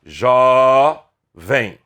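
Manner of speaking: Jovem split into jo-vem; teacher dividing Jovem; syllable split. Shown as a demonstration. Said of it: "Como é que se separam as sílabas".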